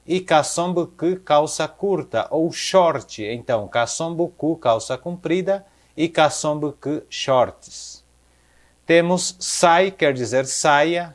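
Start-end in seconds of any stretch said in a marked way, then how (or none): none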